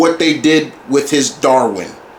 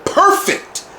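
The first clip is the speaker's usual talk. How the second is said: perfect jumps way up in a squeak; squeaky high perfect. Real, not acted. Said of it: "damn near".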